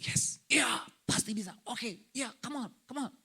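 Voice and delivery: muttered self pep talk in short bursts; athlete psyching up. Acted. Preacher acting an athlete before a match, murmured self-encouragement. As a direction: pump yourself up under your breath. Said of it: "kalau atlet nih mau tanding nih".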